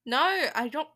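accidental touch of British accent; lightly British, loud opening word; British slip. Real, not acted. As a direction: slip briefly into British vowels. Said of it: "I just went British for a moment".

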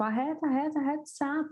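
high-pitched mock yell of stop, stop; doctor yelling stop. Doctor yelling at the pushing mother to stop, high mock yell. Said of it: "ela começou a gritar em francês".

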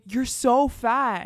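loud, drawn-out mock insult; stretched, emphatic retold jab. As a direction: imitate a classmate blurting it out, slowly. Tease repeated as everyone turns.

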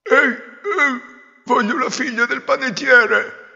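high, loud grunting demand; grunting pig prince. Acted. Pig prince demanding the baker's daughter, grunting high and loud. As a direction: whine and grunt like a spoiled pig.

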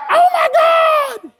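a hammed-up scream at the storm; mock panic. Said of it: "you're like".

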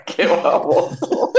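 laughing. Real, not acted. Laughs at friend's joke.

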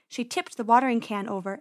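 ordinary delivery

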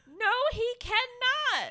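high, slow mocking reply; sarcastic retort. Answering George's pep talk to Ken, slow high mockery. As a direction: drag it out in disbelief.

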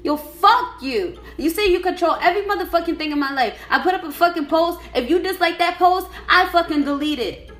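high, loud, escalating tirade; furious rant. Real, not acted. Raging at an ex who controls their life, escalating fury. Ranting about ex's control.

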